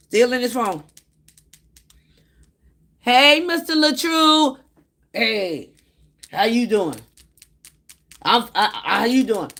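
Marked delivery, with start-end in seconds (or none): 3.0-4.6 calling out loudly to get his attention. Retold or imitated. Speaker to ignoring host, calling him loudly. 5.1-9.5 a hint of a higher, mumbling distracted host; imitated distracted man. Host to visitor, distracted stammering reply.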